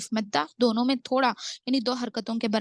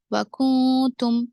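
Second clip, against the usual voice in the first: slow, drawn-out tajweed chant; Quranic recitation; 2 semitones higher, 5 dB louder, slower than usual. Shown as a demonstration. Tajweed teacher reciting for students, slow measured chant. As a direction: recite the verse slowly with elongated vowels.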